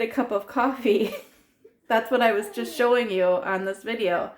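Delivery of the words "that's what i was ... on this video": ordinary delivery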